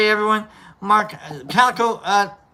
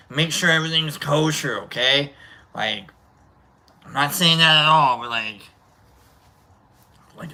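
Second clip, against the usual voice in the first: usual speaking voice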